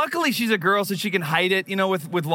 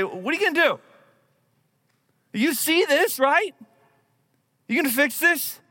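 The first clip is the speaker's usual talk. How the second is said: pleading to God; 9 semitones higher than usual. Retold or imitated.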